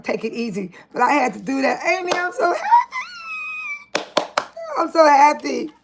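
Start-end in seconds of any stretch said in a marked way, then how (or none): 1.9-4.0 slow, breaking happy sob; tearful and overjoyed, very high and slow; crying with joy. Real, not acted. 4.8-5.7 joyful. Real, not acted. Joy after crying.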